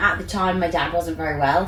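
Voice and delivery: ordinary delivery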